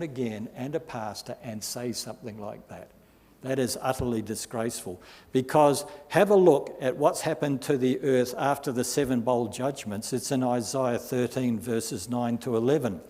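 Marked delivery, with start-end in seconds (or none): none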